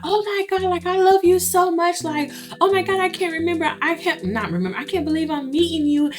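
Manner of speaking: high, breathless fan squeal over her idol; gushing fangirl. Retold or imitated. Friend meeting [name], imitated as a gushing, high fangirl. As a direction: gush like you can't believe you're meeting him.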